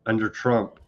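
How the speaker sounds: ordinary talk, nothing marked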